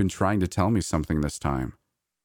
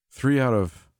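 louder and higher, reading out; 6 semitones higher, slower than usual. A narrator's reading. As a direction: start reading it aloud. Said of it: "say it out loud".

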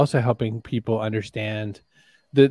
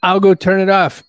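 raised, higher voice over noise; calling out; 7 semitones higher, 6 dB louder than usual. Real, not acted. Co-host offering to switch off the AC, calling out. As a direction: call it out loudly.

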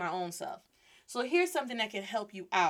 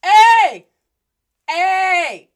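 loud, high, drawn-out shout; attention-getting call; 10 semitones higher, 19 dB louder, much slower than usual. Acted. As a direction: holler to catch someone walking away. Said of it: "Get their attention to say".

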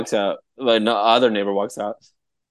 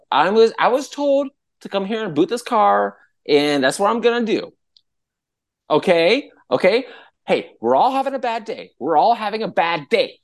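high-pitched, pleading self-justification; flustered defensiveness; 10 semitones higher than usual. Retold or imitated. Booter facing her coworkers, high flustered defence. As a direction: plead your case to a hostile group.

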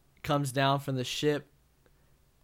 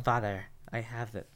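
snippy, quieter mock Nebula line; 2 semitones lower, 7 dB quieter than usual. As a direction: say it like a snotty daughter. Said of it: "in her bitchy voice".

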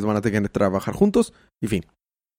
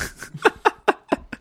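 breathy burst of laughter; real laugh after the joke. Real, not acted. (risa real)

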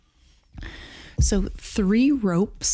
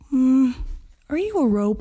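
suspicious bartender; 7 semitones higher than usual. Acted.

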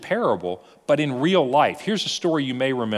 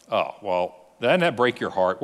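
normal talking voice

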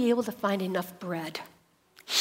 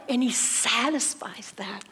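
whispering; 5 dB quieter than usual. Acted. Soft answer after craving voice.

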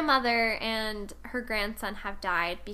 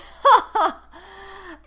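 high squealing laugh; loud laugh; 8 semitones higher, 11 dB louder than usual. Real, not acted. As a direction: burst out laughing. Laughing at stolen tokens.

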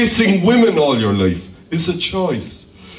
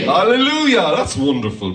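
ordinary talk

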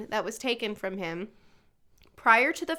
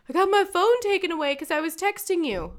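higher, louder, crying mock kid voice; imitating a whiny upset kid; 8 semitones higher, 5 dB louder than usual. Mom imitating her son complaining his phone was taken, mock crying. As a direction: whine it like a tearful middle schooler. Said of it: "He is noticeably upset".

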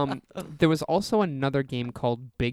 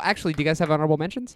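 usual speaking voice

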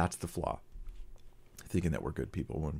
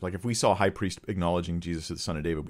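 ordinary talk

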